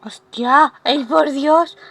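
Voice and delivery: moved to tears by the game scene, let it crack; emotional gush while crying; real tearful outburst. Real, not acted. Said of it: "estoy llorando".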